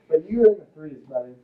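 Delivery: normal talking voice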